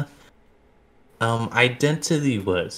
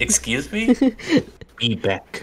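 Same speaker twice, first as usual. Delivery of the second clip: caveman talk; 6 semitones higher, 3 dB louder than usual. Retold or imitated. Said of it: "sound like caveman talk".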